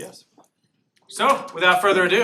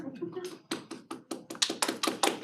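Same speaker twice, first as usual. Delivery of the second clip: breathy high laugh; 13 semitones higher, 10 dB quieter than usual. Real, not acted. Laughs at own slide mistake.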